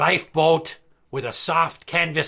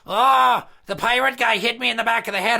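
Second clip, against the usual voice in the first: put-on high, loud whiny kid tattling; mock crying kid; 8 semitones higher, 4 dB louder than usual. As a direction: ham up a wailing little brat telling on you. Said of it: "he's crying like".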